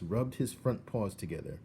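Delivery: usual speaking voice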